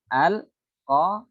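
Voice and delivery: Al-qamaru with the lam clearly closed before qa, a bit slow; al-qamaru lam sukun. Shown as a demonstration. Teacher showing lam sukun before qa, careful al-qamaru. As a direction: say Al-qamaru keeping the l audible. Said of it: "Lamnya dibaca sukun".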